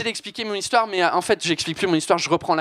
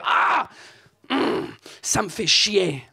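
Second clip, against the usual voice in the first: mock annoyed; much slower than usual. Shown as a demonstration. Comedian showing how to vent, mock fed-up. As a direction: show how a fed-up Frenchman vents. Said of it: "si je suis énervé".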